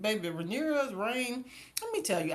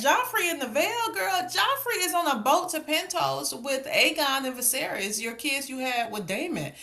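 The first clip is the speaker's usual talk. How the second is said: incredulous outburst; 7 semitones higher than usual. Retold or imitated. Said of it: "I said".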